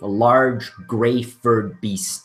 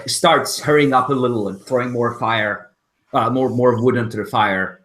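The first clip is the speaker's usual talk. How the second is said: normal talking voice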